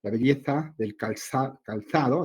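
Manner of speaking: belleza and calzado with a lisped th, /beʎeθa/, /kalθaðo/; Castilian accent, z as th in calzado; Spanish z sound. Shown as a demonstration. Teacher showing the Spain pronunciation of calzado, Castilian accent. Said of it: "en España es muy notorio el sonido de la Z".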